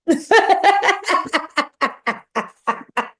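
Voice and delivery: a long, high, loud burst of laughter; real laugh at the abuser's sudden meekness. Real, not acted. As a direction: laugh hard at how he backed down. Laughing at his meek reply.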